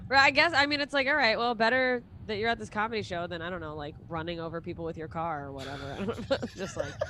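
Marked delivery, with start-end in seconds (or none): none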